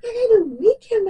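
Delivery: high, loud, laughing outburst; talking through laughter. Real, not acted. Telling viewers about a menopausal week, laughing helplessly. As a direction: push the words out through real laughter.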